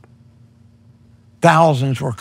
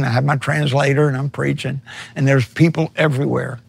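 normal talking voice

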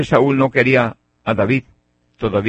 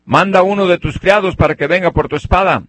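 high, raised call to someone far off; 5 semitones higher than usual. Retold or imitated. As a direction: shout it across to a king. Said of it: "le grita, le dice".

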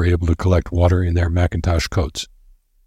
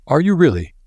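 higher, eager and intrigued; sudden interest; 9 semitones higher than usual. A narrator's reading. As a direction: perk up at the news. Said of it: "with a new note of interest".

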